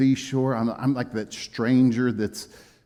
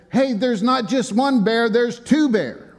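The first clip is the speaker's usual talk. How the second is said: calling out; 10 semitones higher, 4 dB louder than usual. Retold or imitated. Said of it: "somebody in another cabin kinda close by said".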